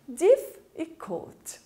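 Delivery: "Difficult" pulled apart very slowly, starting high; syllable by syllable. Shown as a demonstration. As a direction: break "Difficult" into diff, then i-cult. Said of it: "Tem duas sílabas".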